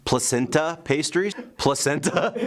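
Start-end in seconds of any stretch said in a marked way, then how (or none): 1.6-2.5 laughing. Real, not acted. Laughs reading dish name.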